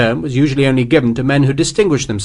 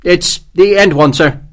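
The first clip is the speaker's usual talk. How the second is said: higher, louder nervous officer; 7 semitones higher, 5 dB louder than usual. A narrator's reading. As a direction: answer the general anxiously, eager to please. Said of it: "he said nervously".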